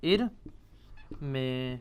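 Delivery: ارمے stretched very slowly and softly, vowels held long; soft slow teacher model of ارمے; drawn-out model word. Shown as a demonstration. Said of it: "ارمی سے بن جائے گا".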